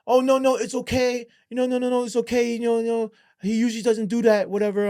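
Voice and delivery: very high-pitched, hurried placating voice; imitated pleading girlfriend. Girlfriend excusing her boyfriend to police, high placating voice.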